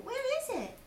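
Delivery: put-on high eager child; high excited question; Roo voice. Roo asking about the sleigh, high voice.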